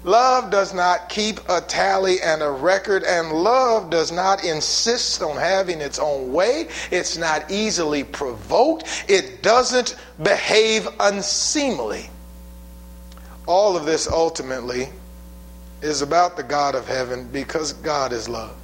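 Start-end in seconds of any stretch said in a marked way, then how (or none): none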